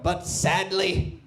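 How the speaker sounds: slow, grave "But sadly"; mock documentary gravity; documentary narrator. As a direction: drag out sadly like a nature narrator. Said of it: "the music will change".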